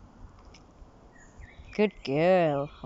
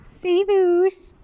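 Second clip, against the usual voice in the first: much higher sing-song farewell; high goodbye to cat; 10 semitones higher, 7 dB louder, slower than usual. Real, not acted. Owner trills goodbye to the cat. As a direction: trill a bye to the kitty.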